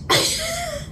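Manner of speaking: sudden very high-pitched laugh. Real, not acted. Storyteller recalling online hate, high laugh. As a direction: let out a high burst of laughter.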